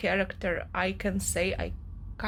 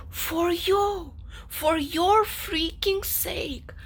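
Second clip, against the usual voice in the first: high, then a slow angry whisper on "for your freaking sake"; furious, exasperated plea; 7 semitones higher, much slower than usual. Real, not acted. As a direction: spit it out through the anger. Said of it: "I'm so angry".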